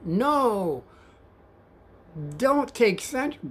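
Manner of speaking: retold scream. Passenger objecting to the cab driver's route, mock scream. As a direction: imitate a screaming passenger without full volume. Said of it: "but he screams out".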